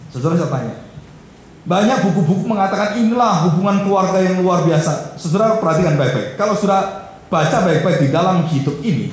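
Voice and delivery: loud, high-pitched sermon delivery; forceful preaching. Acted. Preaches with raised voice.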